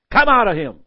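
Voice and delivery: loud, firm order; commanding rebuke. Acted. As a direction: cast the demon out with authority. Jesus rebukes the demon.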